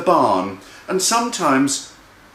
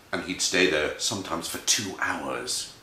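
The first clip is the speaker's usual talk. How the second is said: low, soft storyteller hush; hushed narration; 10 semitones lower, 7 dB quieter than usual. A narrator's reading. Storyteller tells how the mouse froze, hushed and low. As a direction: drop low and quiet after the shock.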